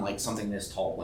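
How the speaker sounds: normal talking voice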